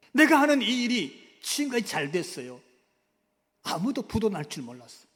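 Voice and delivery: loud, driving preacher's delivery; forceful preaching. Acted. Preacher describing the seizure to the congregation, loud. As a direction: proclaim it with full force.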